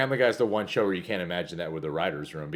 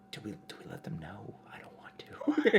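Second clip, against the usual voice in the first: conspiratorial whisper; 6 dB quieter than usual. Retold or imitated. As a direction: hush it like a nervous secret. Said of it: "Fox is just like".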